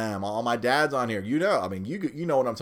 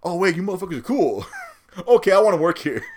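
quick, higher, relieved imitation; coworker's relief; 7 semitones higher, 6 dB louder, faster than usual. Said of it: "and he's like".

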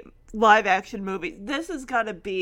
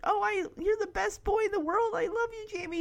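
higher, softened doting voice; adoring; 7 semitones higher, 5 dB quieter, faster than usual. Retold or imitated. Chance tells Jamie he loves him, high soft adoration. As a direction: gush tenderly like a loving dog. Dog adores boy at reunion.

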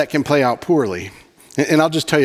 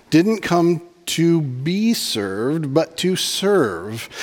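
slow emphatic quote; 2 semitones higher, much slower than usual. Acted. Slow reading of Jesus' words.

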